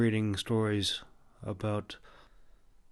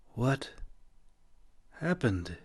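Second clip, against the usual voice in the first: dazed question; 3 semitones higher, slower than usual. A narrator's reading. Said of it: "he asked".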